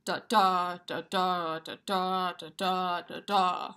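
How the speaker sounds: every second da stressed, steady pulse; iambic beat. Shown as a demonstration. Teacher chanting a full pentameter line, metronomic. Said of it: "it goes da".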